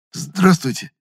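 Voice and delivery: high-pitched, slightly stuttered greeting; startled stammer. A narrator's reading. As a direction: trip on the first sound, caught off guard. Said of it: "От неожиданности чуть заикнулся я".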